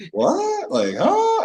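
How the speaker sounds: very high, drawn-out disbelief; mock bafflement. Rookie at the back hearing Peyton's call, high mock disbelief. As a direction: squeak it out, utterly thrown.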